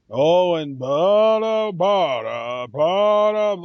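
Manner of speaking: slack-jawed, slow dopey mumble. Retold or imitated. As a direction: imitate a dopey big guy drawling. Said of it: "he sounds so dopey to me".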